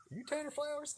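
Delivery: high, quiet questioning stranger; airport stranger. Retold or imitated. Airport stranger asking if he's Tanner Flowers, high and soft. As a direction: ask it like a timid fan.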